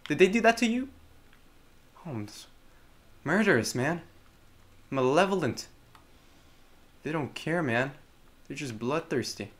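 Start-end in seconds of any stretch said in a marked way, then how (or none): none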